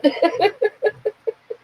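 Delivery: high real laughter; a real laugh spilling out. Real, not acted. Herself to friends, laughing high and free.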